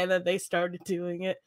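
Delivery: normal talking voice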